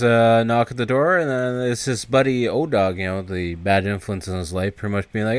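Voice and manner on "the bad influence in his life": ordinary delivery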